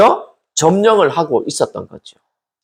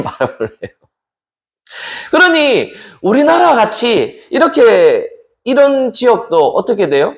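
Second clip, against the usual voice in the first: ordinary talk, nothing marked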